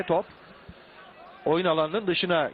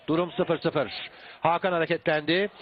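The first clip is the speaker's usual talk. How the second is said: ordinary talk, nothing marked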